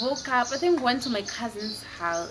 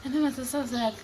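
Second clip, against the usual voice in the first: normal talking voice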